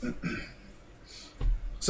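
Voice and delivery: talking through a yawn; slow yawning speech. Real, not acted. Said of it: "don't know why I'm yawning".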